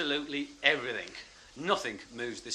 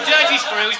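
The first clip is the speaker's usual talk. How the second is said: high, loud jeer; loud, gleeful heckle; mimicked prisoner abuse; 9 semitones higher, 15 dB louder, slower than usual. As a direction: holler it along with the Scotsman. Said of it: "I've gotta try that".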